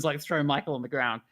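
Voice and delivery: usual speaking voice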